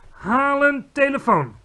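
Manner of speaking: high, loud, slow order; commanding. A narrator's reading. Queen orders a servant about, commanding. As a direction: command it with royal authority. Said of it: "beval de koningin".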